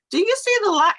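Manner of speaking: loud, high mom scolding, imitated; exasperated mom. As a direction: snap at an interrupting daughter. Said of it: "normally that's how I would talk to her".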